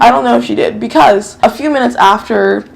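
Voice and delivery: ordinary delivery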